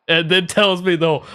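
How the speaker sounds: ordinary talk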